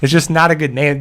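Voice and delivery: ordinary talk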